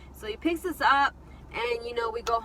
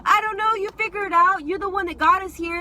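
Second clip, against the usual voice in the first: higher, louder mock outburst; retold upset screaming; 7 semitones higher, 6 dB louder than usual. Teller snapping at her stranded friend, acted upset retort. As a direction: throw the blame back, voice raised. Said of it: "I'm over there screaming, crying".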